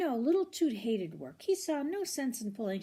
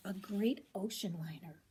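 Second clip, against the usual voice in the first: ordinary delivery, nothing marked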